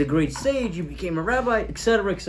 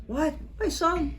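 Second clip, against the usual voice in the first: stunned disbelief; 9 semitones higher, 4 dB quieter, slower than usual. Acted. Father hearing his lost son is alive, stunned high. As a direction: pitch jumps high on my son.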